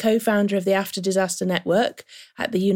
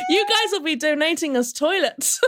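laughing; 8 semitones higher than usual. Real, not acted. Joking about Britain needing aid.

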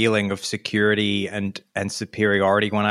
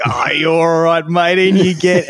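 higher, louder broad Australian officer, lightly put on; easygoing broad Australian wave-through; Aussie officer; 8 semitones higher, 5 dB louder than usual. Officer waving the traveller in, light broad Australian accent.

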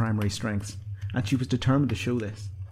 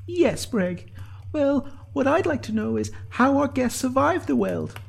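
high-pitched, earnest asking; serious question; slower than usual. A narrator's reading. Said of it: "Sinead asked the others, seriously".

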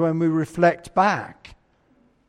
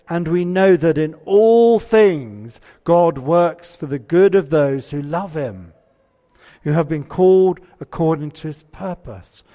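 scripture reading; 6 dB louder than usual. Acted. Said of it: "Romans eight twenty-eight".

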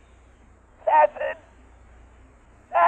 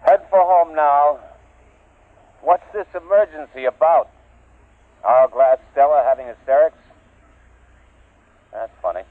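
throat mic; 4 dB louder than usual. Acted. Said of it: "He had a throat mic on".

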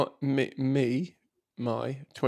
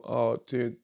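ordinary talk

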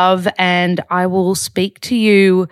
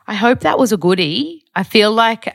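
ordinary talk, nothing marked

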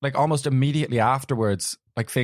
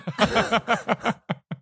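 laugh; 10 semitones higher than usual. Real, not acted. Laughs at name and shame.

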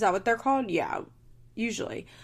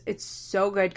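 normal talking voice

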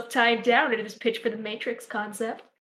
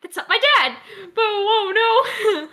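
loud, laughing, mock-anguished cry; melodramatic yell; 8 semitones higher, 6 dB louder, slower than usual. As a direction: wail it like a soap villain. Acting melodramatic denial.

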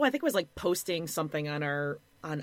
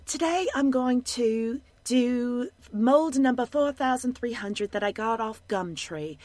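imitated charming Australian-British accent, higher pitched; 5 semitones higher than usual. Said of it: "Australian or some cool British accent".

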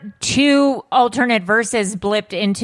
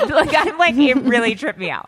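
real laughter breaking into the words; higher-pitched, laughing as you talk; 6 semitones higher than usual. Real, not acted. Amused podcaster reacting to a trippy story, laughing. Laughing while talking at start.

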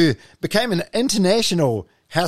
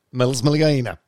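usual speaking voice

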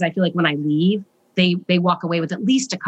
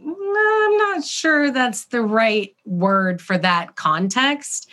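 drawn-out "Mm", slow, higher, tactful doubt; hesitant doubt; 6 semitones higher, much slower than usual. Retold or imitated. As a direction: gently cast doubt, stretching it out. Parent doubting son's word choice.

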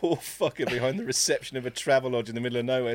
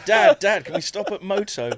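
put-on high, eager kid's voice pleading; child asking dad to stop at services; child begging; 5 semitones higher, 3 dB louder than usual. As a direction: whine like a kid in the back seat.

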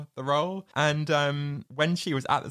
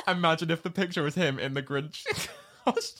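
giggling all through the line; words bubbling with real laughter; laughing through words; 5 semitones higher, faster than usual. Real, not acted. Co-host cracking up at the Grinch picture idea, laughing.

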